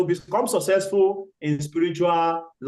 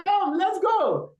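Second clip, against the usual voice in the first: coaxing crocodile; 11 semitones higher, much slower than usual. Acted. Crocodile coaxes from below.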